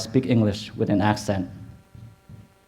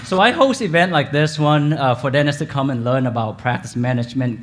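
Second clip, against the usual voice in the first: ordinary delivery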